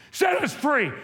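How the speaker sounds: cried out. Retold or imitated. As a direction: build the chant to a peak. Chant built to a cry.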